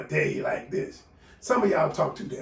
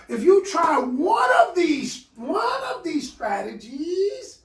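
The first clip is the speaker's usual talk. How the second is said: excited hype; 10 semitones higher, 5 dB louder, slower than usual. Real, not acted. Hyping the audience.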